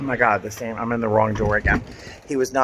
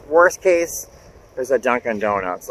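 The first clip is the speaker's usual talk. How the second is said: ordinary talk, nothing marked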